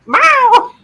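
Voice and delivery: loud, high-pitched meow; cat meow. Pretend. Talking back to Maya the cat, loud mimicked meow.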